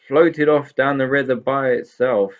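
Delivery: raging. A narrator's reading. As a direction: keep raging about the long walk. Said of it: "raged Mister Hey There".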